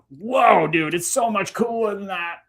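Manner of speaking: high, drawn-out awestruck whoa; mock amazement. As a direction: gush like an amazed dude. Mock-amazed reply to closed minds.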